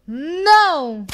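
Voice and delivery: defiant loud no; firm no. A narrator's reading. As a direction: plant your feet and refuse. One stands up to Red.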